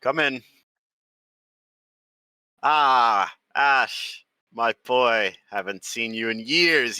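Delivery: loud, jovial big man voice; booming hearty cook. Acted. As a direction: boom out a warm welcome. Hearty cook greets old friend.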